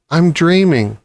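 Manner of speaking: plain reading voice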